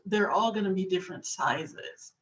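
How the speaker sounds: ordinary delivery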